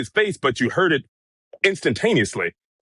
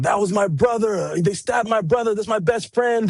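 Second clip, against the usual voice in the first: imitated kids yelling; 9 semitones higher than usual. Reporter relaying the kids at the stabbing scene, imitated yelling. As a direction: imitate distressed kids calling out in a raised pitch. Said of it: "the kids yelling out".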